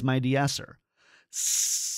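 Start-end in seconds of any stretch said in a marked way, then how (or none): 1.3-1.9 long steady hiss; sustained sibilant hiss; held S. Shown as a demonstration. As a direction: hold out a hissing S sound. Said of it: "I'm gonna hold a S sound".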